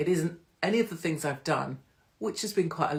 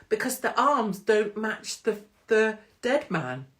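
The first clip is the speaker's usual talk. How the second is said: ordinary delivery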